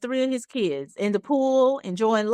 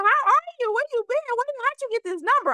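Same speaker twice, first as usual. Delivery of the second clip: high, rushed string of questions; flustered, firing questions; shook and gushing; 14 semitones higher, faster than usual. Retold or imitated. Woman caught off guard by an old flame's call, much higher. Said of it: "I'm just shook".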